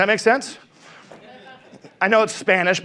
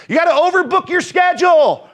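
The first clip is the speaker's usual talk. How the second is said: louder, higher rallying shout; shouted push; 6 semitones higher, 3 dB louder than usual. Acted. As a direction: hammer it home to the room. Fired-up pep talk to agents.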